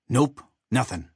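lightly voiced character reply; a hint of a character voice, matter-of-fact; Bomb. A narrator's reading. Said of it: "Bomb replied".

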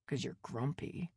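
quiet muttered jab. A narrator's reading. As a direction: mutter it under your breath. Son muttering from inside.

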